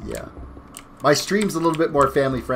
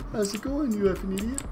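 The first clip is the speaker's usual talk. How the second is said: higher, softer, sugary "you effing idiot"; mock rude Canadian greeting; 7 semitones higher, 6 dB quieter than usual. Streamer jokingly showing what "eh" really means, sugary and high. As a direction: insult wrapped in a polite tone. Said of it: "everybody thought Canadians were so polite".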